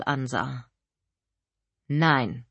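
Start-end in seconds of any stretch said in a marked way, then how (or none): 1.9-2.4 bestimmt. A narrator's reading. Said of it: "sagte ich bestimmt".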